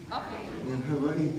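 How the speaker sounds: ordinary delivery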